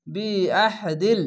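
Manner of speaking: slow, drawn-out chanted word; Quran recitation. Shown as a demonstration. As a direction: stretch each syllable like tajweed. (টেনে টেনে তিলাওয়াত)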